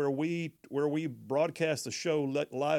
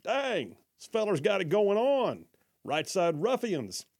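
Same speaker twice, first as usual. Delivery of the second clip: higher-pitched, mock-amazed fan; impressed listener; 6 semitones higher, slower than usual. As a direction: play a listener who's wowed.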